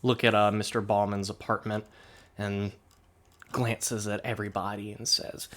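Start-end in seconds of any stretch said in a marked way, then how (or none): none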